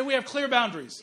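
loud call for the crowd to repeat; preaching call. Shown as a demonstration. Pastor leads congregation to repeat the point, loud and emphatic. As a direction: lead the congregation in a loud declaration. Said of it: "Everybody say".

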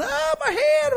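very high mock yelp of pain; hammed-up high-pitched ouch; acted pain cry. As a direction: cry out like a burnt child, pitch leaping. Acts out burning his hand.

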